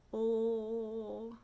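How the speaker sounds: "Oh" as an even, sustained L; long L sound. Shown as a demonstration. Teacher confirming a long sound, L held steadily. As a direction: hold the L sound written "Oh". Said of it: "or a long sound".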